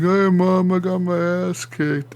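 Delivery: high-pitched put-on voice whining to mom; kid to mom. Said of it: "So then I go back to my mom".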